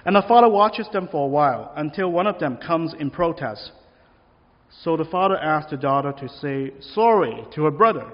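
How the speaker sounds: ordinary delivery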